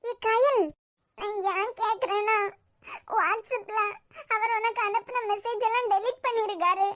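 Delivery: normal talking voice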